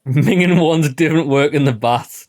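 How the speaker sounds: put-on slow, loud parroting of the Northern accent; deliberate copy of the Northern accent; mock Northern accent. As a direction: echo the NPC in a hammed-up Geordie twang. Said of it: "This guy's got four different accents".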